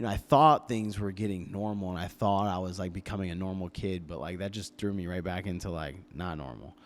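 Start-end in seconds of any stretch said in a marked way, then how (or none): none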